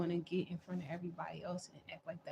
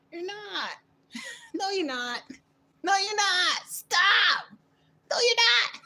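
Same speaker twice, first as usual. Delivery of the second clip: shrieking laughter, much higher and louder; laughing shout; 14 dB louder, slower than usual. Real, not acted. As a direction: crack up while yelling you're not. Mocking rival, laughing, shouting.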